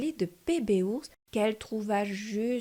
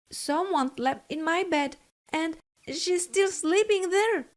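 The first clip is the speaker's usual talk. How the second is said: surprised exclamation; 7 semitones higher than usual. A narrator's reading. Narrator voicing Baby Bear discovering the sleeping girl, surprised. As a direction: gasp it out in shock like a tiny cub. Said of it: "exclaimed Baby Bear in surprise".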